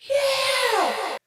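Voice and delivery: very high, drawn-out yell; long, loud "yeah" cheer; imitated crowd roar. Elevator riders cheering back at a drunk woman, long high yell.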